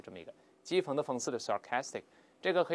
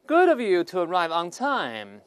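slow, loud, higher-pitched mock praise; heavy irony; 4 semitones higher, 9 dB louder, slower than usual. George greeting someone hours late, heavily ironic. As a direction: lay on the sarcastic compliment thickly. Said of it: "George said with heavy ir-，irony".

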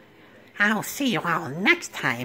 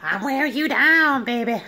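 higher, louder nerdy flirt; pushy nerd sure he's winning her over; Urkel flirting; 5 semitones higher, 6 dB louder than usual. Retold or imitated. Urkel telling Laura he's wearing her down, nerdy flirt. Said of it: "That's my favorite".

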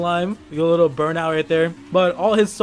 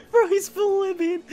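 high-pitched laughing through the words; cracking up as the car flips; laughing hard; 14 semitones higher than usual. Real, not acted. Streamer watches a friend's car flip, laughing hard.

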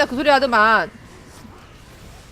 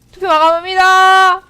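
mock announcer; 6 semitones higher, 7 dB louder, slower than usual. Announcing surrender vote close.

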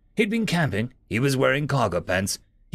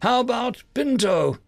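plain reading voice

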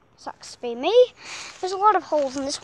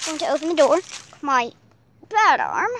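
normal talking voice

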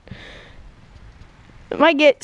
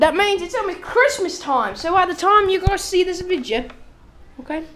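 higher hammed-up Aussie vlog host voice; mock Australian accent; 8 semitones higher than usual. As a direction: address the viewers as a fake Aussie. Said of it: "You're not Australian".

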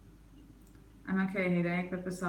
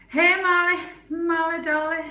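much higher, louder sing-song hello; excited greeting; 10 semitones higher, 9 dB louder than usual. Real, not acted. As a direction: greet a friend joyfully. Greets arriving viewer.

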